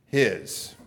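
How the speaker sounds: usual speaking voice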